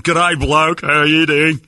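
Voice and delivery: high, loud tourist Aussie; hammed-up fake Australian accent; mock Australian accent. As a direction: bellow like a clueless faux Australian. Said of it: "walking around your country going".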